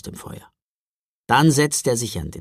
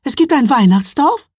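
much higher, loud thrilled cry; 12 semitones higher, 10 dB louder than usual. A narrator's reading. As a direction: squeal it out in amazement. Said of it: "rief sie ganz aus dem Häuschen". (ganz aus dem Häuschen, hoch und laut)

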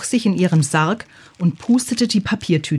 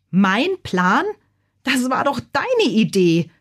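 indignant retort, rising higher; protesting cry; 5 semitones higher than usual. A narrator's reading. Bazilla answers the gargoyle's blame, protesting. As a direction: throw the blame back, pitch climbing. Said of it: "rief Bazilla".

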